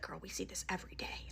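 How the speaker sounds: hushed aside from a store worker; imitated secretive whisper; confiding whisper. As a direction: whisper it like shared gossip.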